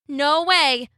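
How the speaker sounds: high cheeky refusal; cocky little cookie voice; gingerbread man. A narrator's reading. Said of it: "said the gingerbread man".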